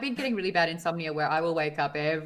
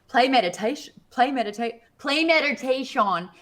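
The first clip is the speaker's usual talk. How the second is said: mock frustration, hammering out the command; exasperated retold command; 7 semitones higher, 7 dB louder than usual. Repeating to a voice assistant that can't understand, exasperated.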